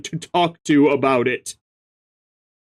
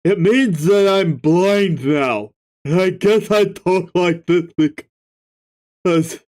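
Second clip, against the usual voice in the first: slow deliberate retake; slower than usual. Acted.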